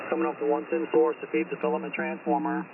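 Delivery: usual speaking voice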